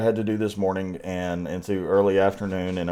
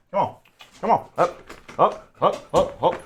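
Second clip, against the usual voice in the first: calling the dog; 9 semitones higher than usual. Real, not acted.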